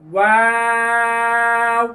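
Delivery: slow drawn-out ওয়াও, round, open, round; letter name Waw. Shown as a demonstration. Teacher demonstrating ওয়াও in front of a mirror. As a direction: glide ওয়াও with lips rounding twice. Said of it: "শুরুতে গোল, মাঝখানে ... শেষ আবার গোল".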